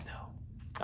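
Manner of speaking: hushed, near-whispered; keep it quiet. Shown as a demonstration. Teacher reminding children to keep it quiet, hushed. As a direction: drop to a hush. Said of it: "we need to keep it quiet".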